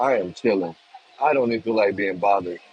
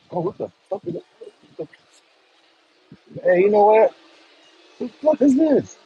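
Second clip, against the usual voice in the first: sudden high yelp of disgust, much higher; what-the-hell shriek, much higher; startled freak-out; 11 semitones higher, 4 dB louder than usual. Real, not acted. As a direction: jump and squeal at the shock. Bird droppings land on him.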